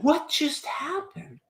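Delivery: hushed mock bewilderment; stunned. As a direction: ask it in quiet disbelief after silence. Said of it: "And I was like".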